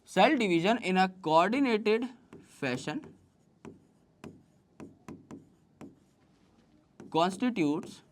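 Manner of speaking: slow, soft, word by word with long pauses; slow dictation. Acted. Teacher dictating the cell cycle definition to students, very slow. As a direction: let them write every word down.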